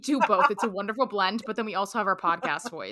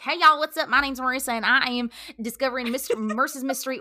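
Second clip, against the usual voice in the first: louder and higher, a peppy influencer greeting; bubbly, loud hey y'all voice; 3 semitones higher, 6 dB louder than usual. Retold or imitated. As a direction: burst in with bright, bouncy excitement. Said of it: "I just changed my voice so much".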